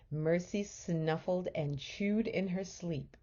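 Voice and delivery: usual speaking voice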